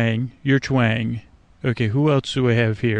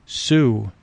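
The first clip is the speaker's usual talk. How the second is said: Sue stretched long and slow, like a frog call; long drawn-out Sue. Shown as a demonstration. Naming a frog after its call, stretched long. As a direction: draw out Sue like a frog's call. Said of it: "with a long".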